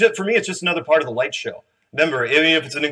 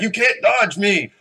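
put-on higher, slow movie-boxer accent; hammed-up boxer accent, pitched up; Clubber Lang; 8 semitones higher, 4 dB louder, slower than usual. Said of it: "I shouldn't do those accents".

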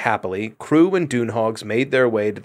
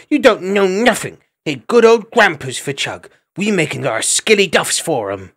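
a small squirrel defiantly answering back; cheeky little squirrel; 7 semitones higher than usual. A narrator's reading.

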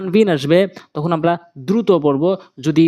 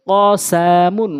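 drawn-out syllable by syllable reading; slow demo; much slower than usual. Shown as a demonstration. Teacher showing learners the word first, slowly. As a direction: stretch each syllable for learners. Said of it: "প্রথমে আমি slow বলছি".

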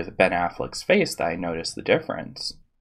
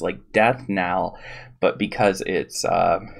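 ordinary talk

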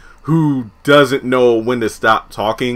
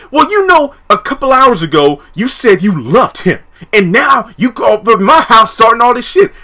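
high accusing voice; 8 semitones higher, 3 dB louder than usual. Retold or imitated. Pam accusing Tom.

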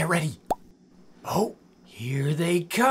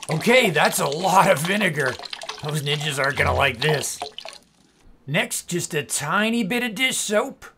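normal talking voice

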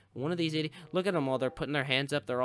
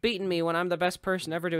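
higher, louder, fast indignant rant; indignant outburst; 6 semitones higher, 8 dB louder, faster than usual. Real, not acted. Gamer outraged at a racer passing him, fast indignant rant. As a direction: protest at the rival, rushing. Getting passed in race.

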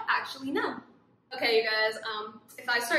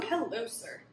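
ordinary delivery

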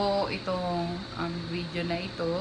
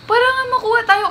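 very loud, high-pitched pleading protest; exasperated insistence; 12 semitones higher, 13 dB louder, faster than usual. Real, not acted. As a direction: insist at the top of the voice. Arguing with companion about the scholarship.

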